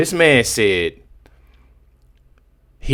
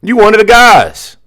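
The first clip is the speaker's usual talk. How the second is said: raised, louder protest; loud exasperation; 6 semitones higher, 7 dB louder than usual. Real, not acted. Reacting to a clip, loudly protests to the speaker. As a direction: call it out loud in disbelief.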